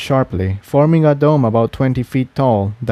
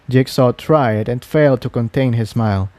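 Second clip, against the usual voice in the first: plain reading voice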